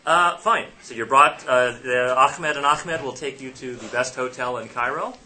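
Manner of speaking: ordinary delivery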